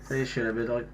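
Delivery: ordinary delivery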